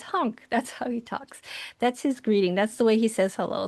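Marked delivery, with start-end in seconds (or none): 0.0-0.4 a short, mild honked bird call; lightly voiced little raven honk; baby raven honk. Teacher voicing her baby raven's greeting, mild honk. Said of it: "And he says".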